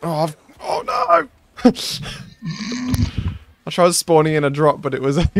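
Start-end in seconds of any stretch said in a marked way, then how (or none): 0.0-3.4 high cry of dismay then laughter; laughing dismay. Real, not acted. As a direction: wail then burst out laughing.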